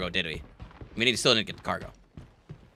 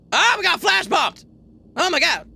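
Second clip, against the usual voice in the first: startled shout; 11 semitones higher than usual. Real, not acted. Gamer caught by a flash bomb, startled shout. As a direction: cry out as the flash hits.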